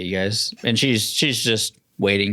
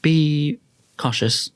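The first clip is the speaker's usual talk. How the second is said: slow warning; 6 semitones higher, slower than usual. Acted. Attendant warning.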